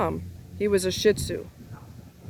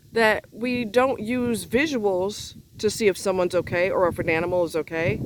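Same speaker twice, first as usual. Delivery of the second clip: normal talking voice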